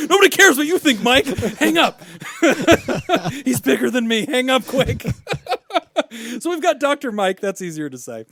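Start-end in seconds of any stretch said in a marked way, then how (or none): 0.1-2.0 loud, high-pitched comic indignation; mock outrage. Mock outrage at guest's verdict. 3.4-5.0 high, loud joking alarm; mock panic. Mock panic about the guest.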